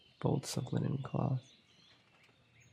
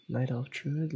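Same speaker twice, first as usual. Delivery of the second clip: normal talking voice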